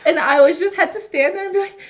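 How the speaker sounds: high, laughing on the edge of tears; half laugh, half sob it out; laugh-crying. Retold or imitated. Laugh-crying retelling reassuring patient.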